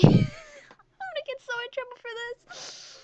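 very high, loud squeal; high-pitched flustered wail; embarrassed squeal. Real, not acted. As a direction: squeal it out, mortified. Embarrassed by quiz's last word.

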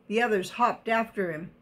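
plain reading voice

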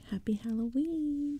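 high, slow, drawn-out greeting; gloating singsong call; taunting chorus. A narrator's reading. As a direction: stretch it out high like a mocking chorus. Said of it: "repeated in unison".

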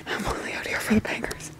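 breathy whisper so nobody nearby hears. Real, not acted. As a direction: whisper to the camera. Quietly filming at the water.

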